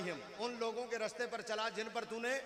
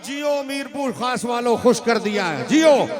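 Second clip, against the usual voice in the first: louder, rousing sermon delivery; full-force preaching; 3 semitones higher, 17 dB louder than usual. Acted. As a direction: fire up the crowd. (जोश भरी तक़रीर)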